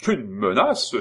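loud, high outraged exclamation; indignant cry. Acted. Simon crying out at Lorrain's threat, loud and indignant. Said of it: "s'écria Simon".

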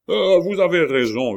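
high, very loud yell; howled agreement. A narrator's reading. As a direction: bellow it out at full pitch. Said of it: "hurla Coconas". (hurlement aigu et puissant)

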